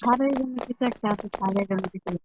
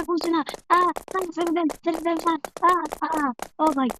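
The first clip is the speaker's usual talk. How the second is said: high frantic begging to be let go; panicked pleading; 5 semitones higher than usual. Acted. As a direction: plead with the monster teacher in panic. Caught by monster teacher, begging.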